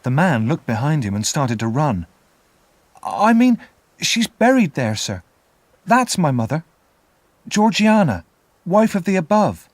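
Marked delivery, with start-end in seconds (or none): 3.0-9.7 put-on high, louder boy's voice rushing to explain; anxious child. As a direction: quickly set the frightened man right. Boy hastily explains to convict.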